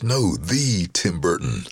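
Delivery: "the" said as a long, emphatic THEE; slow, pointed emphasis on "the"; stress on THE. Retold or imitated. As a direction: draw out "the" to mean the famous one. Agent corrects him, stressing THE.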